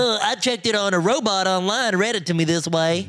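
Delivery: mocking quote. Co-host mocks the other's online-robot excuse, mimicking. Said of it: "Say it the way it's supposed to, not the way".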